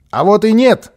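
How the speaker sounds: high loud indignant cry; shouting. A narrator's reading. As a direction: shout it back defiantly. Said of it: "закричал малыш". (громкий возмущённый крик)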